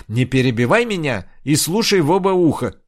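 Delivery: loud, high angry yell; shouting. Acted. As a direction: shout her down for interrupting. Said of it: "закричала Инг". (сердитый крик)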